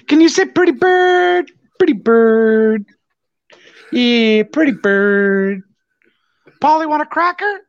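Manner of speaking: high sing-song bird talk; parrot voice. Retold or imitated. Talking to the blind kid's bird.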